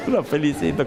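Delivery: said through a laugh; laughing repeat. Real, not acted. The pastor retelling his reply to the congregation, laughing. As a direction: chuckle as you repeat it.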